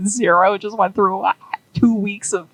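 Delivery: normal talking voice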